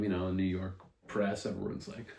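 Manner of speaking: ordinary talk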